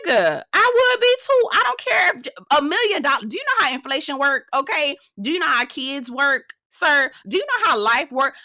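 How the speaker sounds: high, loud incredulous rant; outraged. Real, not acted. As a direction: fire off the questions in disbelief.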